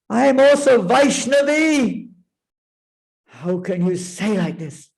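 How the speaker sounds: high, loud, outraged cry; furious. Acted. Parvati furious at Lord Shiva, high and loud. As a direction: hurl the betrayal at him. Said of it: "She was furious".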